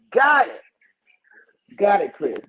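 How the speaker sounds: sarcastic. Real, not acted. Sarcastic retort.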